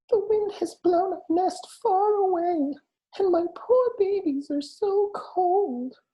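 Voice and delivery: very high, slow squeaking mother mouse; squeaky mouse. A narrator's reading. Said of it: "squeaked Mother Mouse".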